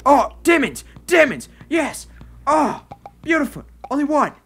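high excited cries; real overjoyed squeals; thrilled. Real, not acted. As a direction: gush with delight. Finding demons, thrilled.

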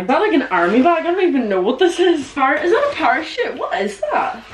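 high, loud, incredulous questions; can't believe what she's pulled out; baffled. Real, not acted. Pulling out a weird old school bag, incredulous and laughing.

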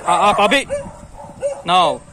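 loud, high call to stop; sharp scolding. Real, not acted. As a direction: snap the dog's name to halt him. Scolding dog charging others.

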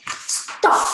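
"Stop" dropping quickly from high to bottom; falling tone with anger. Shown as a demonstration. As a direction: cut "Stop" off like an angry order. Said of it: "from the high pitch and quickly with anger".

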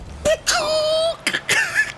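high anguished laughing wail; laugh-cry. Real, not acted. Gamer wailing with laughter after a miss. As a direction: let it break into a cry-laugh.

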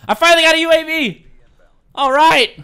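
high, loud happy shout; excited cheer. Real, not acted. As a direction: cheer the reward. Gets a UAV.

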